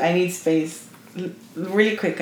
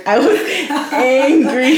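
slow, loud, higher "angry" through laughter; laughing emphasis; 5 semitones higher, 5 dB louder, slower than usual. Real, not acted. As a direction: stretch out "angry", half laughing. Retelling comic fury, laughing.

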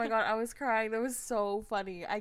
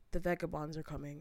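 low, soft imitation of a stranger; quiet ominous stranger; 6 semitones lower, 7 dB quieter than usual. Train stranger warns the passengers, quiet imitation. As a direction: lower and drop the volume for his warning. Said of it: "he gets louder now".

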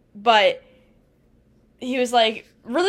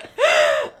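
high squealing laugh; 4 dB louder than usual. Real, not acted. Still laughing at her friend's teasing, a high squeal. After denying friend's joke.

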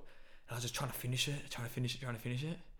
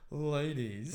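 cheesy pickup line. Retold or imitated. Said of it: "he opened with".

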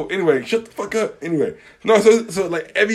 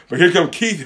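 ordinary talk, nothing marked

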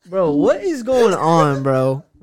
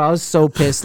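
normal talking voice

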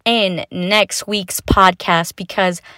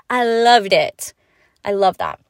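usual speaking voice

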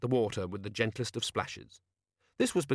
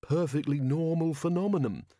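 higher-pitched sceptic's voice, not hushed; lightly voiced grumbler, pitched up; muttering constable; 6 semitones higher than usual. A narrator's reading. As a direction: put on a dismissive grumbling character. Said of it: "muttered Constable Shue".

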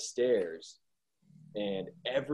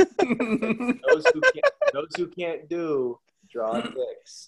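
high, very slow sage voice; mock wise saying; 6 semitones higher, 6 dB louder, much slower than usual. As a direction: deliver it like ancient wisdom. Mock proverb, drawn out.

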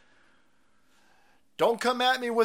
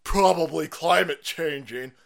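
mocking critics; 9 semitones lower than usual. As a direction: sneer like a smug critic, dropping low. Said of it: "And they're like".

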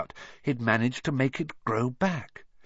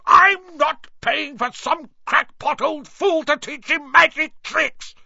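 much higher, louder, slow furious yell; 7 dB louder than usual. A narrator's reading. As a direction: bellow it at the giant in rage. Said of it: "yelled Uncle Vernon".

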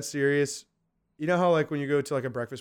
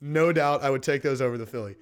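normal talking voice

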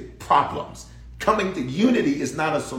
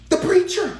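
high, loud cry of the answer; preacher shouting the answer; exclaimed; 5 dB louder than usual. Real, not acted. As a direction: hit it hard and high. Preacher naming the culprit, shouted.

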